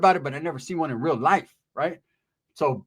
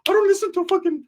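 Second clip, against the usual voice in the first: mock Ricky voice; 13 semitones higher than usual. Narrator voicing Ricky beating the older dude. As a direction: chant it high like a gleeful goof.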